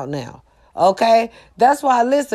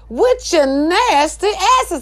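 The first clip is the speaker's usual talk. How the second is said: sassy emphatic jab; 8 semitones higher, slower than usual. Real, not acted. Emphatic repeat.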